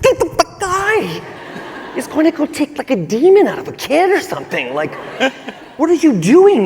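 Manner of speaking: high-pitched, laughing, incredulous yell; incredulous shriek. Retold or imitated. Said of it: "I was just like".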